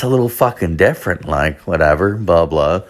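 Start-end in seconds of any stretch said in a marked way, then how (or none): none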